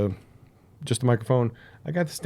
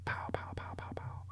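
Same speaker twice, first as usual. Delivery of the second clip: whispered pow sounds; 18 dB quieter than usual. Pretend.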